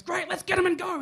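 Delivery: brisk wife eager to leave. Retold or imitated. Wife hurrying him out of the shop.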